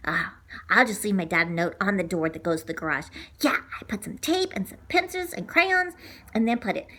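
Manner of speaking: soft breathy whisper. Real, not acted. Kid quietly leaving a note, whispering. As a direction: whisper it secretively. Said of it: "whispered aside".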